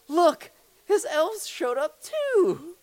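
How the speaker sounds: high, loud, drawn-out shout; acted yell. Dad revealing costumed mice to kid, yelling high and slow. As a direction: yell a second big reveal, stretching it out. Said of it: "back on really quick and yell".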